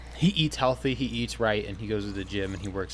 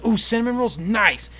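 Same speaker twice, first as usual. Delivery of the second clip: high and louder, eager coworker; all excited; 10 semitones higher, 6 dB louder than usual. Retold or imitated. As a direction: coo over free treats. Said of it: "And he's all excited".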